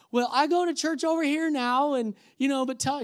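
high, chatty sweet-lady imitation. Woman in car catching up with runner, sweet voice. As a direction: chatter on in her lifted, sweet voice. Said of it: "this sweet voice".

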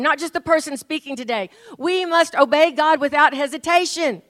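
lifted, fervent preaching voice, pitch up a notch. Acted. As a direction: preach it out to the whole church. Preaching to the congregation.

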